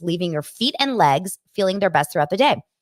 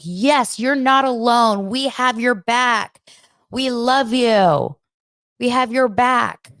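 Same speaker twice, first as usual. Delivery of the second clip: slow, gushing chorus of supportive friends, a bit higher and louder; mocking yes-people; 6 semitones higher, 4 dB louder, slower than usual. Podcaster mocking Tori's friends reassuring her, slow and gushing. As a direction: ham up the cheesy reassurance, drawn out. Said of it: "yes people in the background are like".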